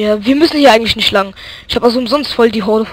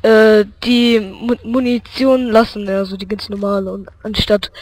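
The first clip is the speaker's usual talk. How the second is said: ordinary talk, nothing marked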